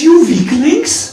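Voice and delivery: loud, high playful insult; imitated jeering yell; mock abusive shout. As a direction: hurl it as a comic taunt. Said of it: "he begins to abuse them".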